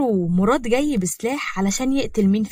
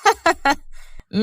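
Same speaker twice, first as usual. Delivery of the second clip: higher-pitched derisive laugh; mocking laugh; 7 semitones higher than usual. Mourad ridiculing talk of baby names, mocking laugh. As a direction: laugh right at them in ridicule. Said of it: "مراد رد بتريقة".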